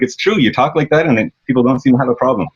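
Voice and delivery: lightly high, agreeable tone; high-pitched voice. Retold or imitated. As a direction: keep it up high and polite. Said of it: "super high-pitched voice".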